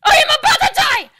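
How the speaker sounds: laughing panic, fading; panicked laughing yell. Real, not acted. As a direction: yell it laughing, then fade. Falling, about to die.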